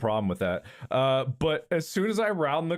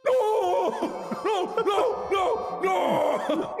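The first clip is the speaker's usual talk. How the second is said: very high repeated yelps; string of mock-distressed wails; imitated dog howling; much slower than usual. Owner imitating dog stuck in side yard, panicked howling. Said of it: "hear her still in the side yard going".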